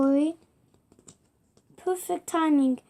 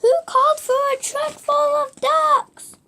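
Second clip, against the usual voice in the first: much higher and louder call into the distance; 11 semitones higher, 6 dB louder than usual. Acted. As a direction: shout the question as if nobody is around. Searching for the customer, calling out.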